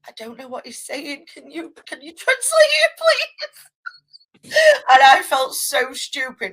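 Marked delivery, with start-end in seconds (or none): 0.1-4.5 flustered plea. Retold or imitated. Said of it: "I went".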